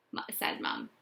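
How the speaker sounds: ordinary delivery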